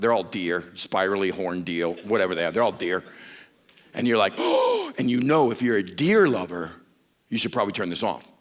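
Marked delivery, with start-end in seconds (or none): 4.4-4.9 very high startled oh; a sudden alarmed oh at the screen; mock surprise. Viewer watching a lion clip, startled oh.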